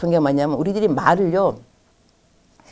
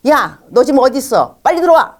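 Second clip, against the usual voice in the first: loud, high-pitched demanding call; bossy parent; 7 semitones higher, 6 dB louder than usual. Acted. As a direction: act out a parent ordering a child home. Parent ordering child home, acted.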